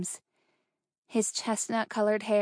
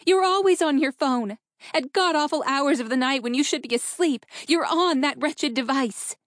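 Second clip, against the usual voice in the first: high, louder angry rant; frustrated outburst; 6 semitones higher, 4 dB louder than usual. A narrator's reading. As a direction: rail at him, exasperated. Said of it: "throwing her hands up in frustration".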